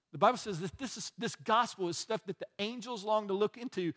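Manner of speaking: ordinary delivery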